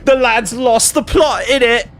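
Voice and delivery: high, slow mock British shout; hammed-up British accent, slow and high. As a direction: ham up a British lad. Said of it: "He's so British".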